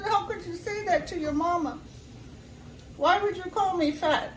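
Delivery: high, indignant retelling of her own reply; hammed-up shocked mother; mock outrage. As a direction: play the hurt mama, pitch way up. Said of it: "I said".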